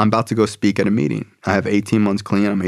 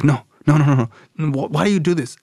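exasperated protest; 6 semitones higher, faster than usual. Retold or imitated. Dad objecting to son's prison friend, exasperated. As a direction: refuse in rapid, repeated protest.

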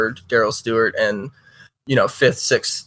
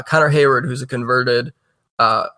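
ordinary talk, nothing marked

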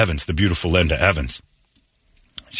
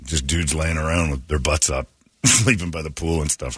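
usual speaking voice

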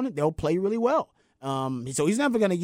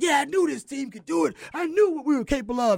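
high, eager fan voice; gushing with confidence, pitched up; excited quote; 9 semitones higher than usual. Retold or imitated. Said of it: "I was like".

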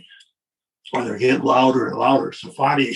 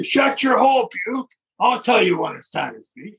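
high drill-sergeant yell, mocking; drill instructor yell; 8 semitones higher than usual. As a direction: bark it down at a recruit like a drill instructor. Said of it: "Jack Webb in the D I".

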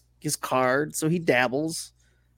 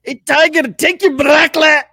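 loud, high, botched Scottish shout; attempted angry Scottish groundskeeper voice; Scottish accent; 11 semitones higher, 10 dB louder than usual. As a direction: holler it in a hammed-up Scottish brogue. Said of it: "but in a Scottish accent".

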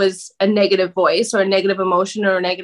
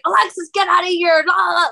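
mock yelling; 8 semitones higher, faster than usual. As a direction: imitate someone yelling at a drunk friend.

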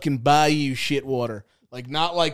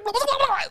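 high squeal. Retold or imitated. Imitating the fleeing raccoon.

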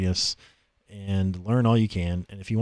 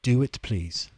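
posh pleading English voice; London accent; slower than usual. Pretend. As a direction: beg politely in a proper accent. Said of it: "It sounds very proper".

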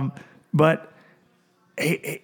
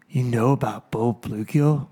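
slow, lower imitation of a surprised pro; lightly voiced amazed friend; 4 semitones lower than usual. Retelling the pro's reaction to his bait, slow lower mock disbelief. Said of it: "Just like that".

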